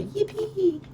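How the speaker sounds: high squealed cheer; mock yippee. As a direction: squeal it like a delighted crowd. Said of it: "Everyone would go".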